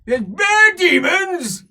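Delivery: loud, high, rowdy dwarf bellow; shouting dwarf slayer; gruff dwarf shout. Acted. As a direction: bark it back like a drunk dwarf. Dwarf slayer dismissing demons.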